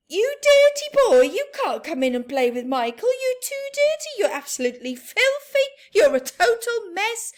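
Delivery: high, loud shrieking scold; mother scolding a dirty boy at the door. Acted. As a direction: shoo away the filthy child.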